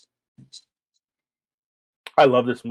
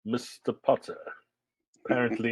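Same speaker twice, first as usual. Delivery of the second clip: a hint of a soft, slow Rickman drawl; Snape drawl; 7 dB quieter than usual. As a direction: lightly drawl it out quietly like Snape. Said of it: "I just love the way he says things".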